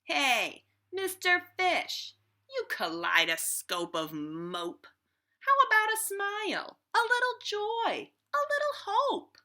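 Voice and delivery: a brash, high, loud squid heckling the fish; impolite squid. Acted. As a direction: bellow it like a cheeky pest. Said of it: "she is slightly impolite".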